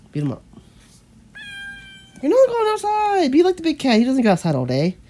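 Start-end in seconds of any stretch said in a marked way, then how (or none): 2.2-5.0 cooing pet scolding. Real, not acted. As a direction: scold a pet in a high, stretched voice. Scolding a pet heading outside.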